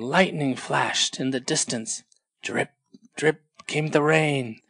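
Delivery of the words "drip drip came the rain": usual speaking voice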